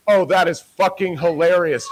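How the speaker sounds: ordinary talk, nothing marked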